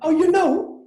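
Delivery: high, loud delighted surprise; real astonished outburst. Real, not acted. Surprised that host knows the park.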